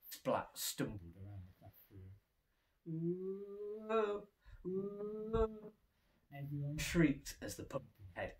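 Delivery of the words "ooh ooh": high spooky ooh ooh; ghostly wooing. A narrator's reading. Pumpkin-headed Splat wooing like a ghost. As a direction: moan like a ghost. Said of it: "strange woo-wooing noises".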